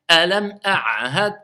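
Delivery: Alam a'had said very slowly, each letter held; careful recitation. Shown as a demonstration. Teacher reciting slowly to show each letter. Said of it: "je sais que c'est un ayn".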